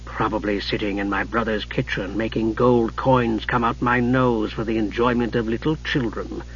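low, very soft, sardonic sorcerer; hushed, withering reply; dry mage retort. Acted. Narrator voices Raistlin retorting.

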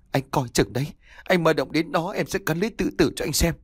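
angry wife scolding her husband; furious wife. A narrator's reading. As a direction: glare and lash out at him. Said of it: "Cô trừng mắt".

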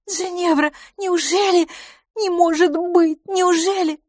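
very high, delighted exclamations; gasp-worthy joy, pitched right up; thrilled disbelief. A narrator's reading. Miss LaFosse can hardly believe the news, thrilled. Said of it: "на этот раз восторженно".

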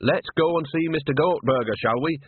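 briskly change the subject, brightly; bright and chirpy. A narrator's reading. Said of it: "said Nanny brightly".

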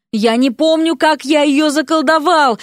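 mild, whiny moan; acted plaintive groan. A narrator's reading. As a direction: moan it helplessly, a little high. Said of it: "простонал тот".

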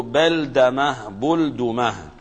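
بلدمة بلدمة slowly, first beldama, then bulduma; both vowellings in turn. Shown as a demonstration. Teacher summing up two forms, slow beldama then bulduma for بلدمة بلدمة. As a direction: contrast بلدمة with fatha, then بلدمة with damma, drawn out. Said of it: "والشكل الثاني بالفتح والفتح، فنقول".

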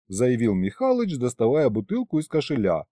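ordinary delivery

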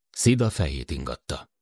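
plain reading voice

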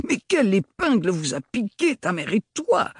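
plus haut, éclat d'effarement; le vieil oncle s'exclame, effaré; exclamation effarée. A narrator's reading. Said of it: "s'exclama le grand-oncle effaré". (exclamation effarée, plus aiguë)

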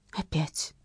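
quiet, far-off inner voice; wistful inner thought. Acted. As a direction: think it sadly to yourself. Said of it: "тоскливо подумала Маша".

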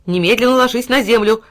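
loud command to the dog; stern order. A narrator's reading. Boy commanding his dog to lie down. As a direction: order him down. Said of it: "приказал Тильтиль".

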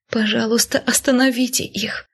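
выше и тише, с дрожью мольбы; умоляй остановить, на грани слёз; испуганная мольба. A narrator's reading. Героиня умоляет в страхе. (отчаянная мольба, выше и тише)

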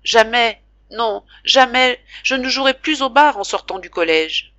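plus haut et plus fort, désespéré; jure-le dans un élan de désespoir; serment désespéré. A narrator's reading. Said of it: "le petit chose se désespérait en lui-même". (serment désespéré, plus haut et plus fort)

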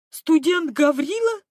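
high, loud astonished question; be amazed to see him. A narrator's reading. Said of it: "изумился Длинный".

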